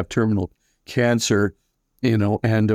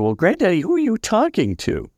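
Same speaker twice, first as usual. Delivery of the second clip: lightly acted puzzled question, pitched high; puzzled relative; 8 semitones higher than usual. Family member asking dying grandfather about his unseen company, lightly voiced puzzlement. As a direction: gently imitate a relative humouring Granddaddy.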